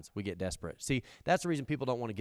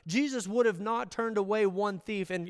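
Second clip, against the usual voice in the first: usual speaking voice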